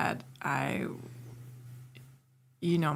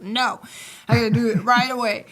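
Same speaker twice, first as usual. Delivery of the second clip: louder, higher mock protest; retold impatient refusal; 5 semitones higher, 7 dB louder than usual. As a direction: act out a child's insistent no.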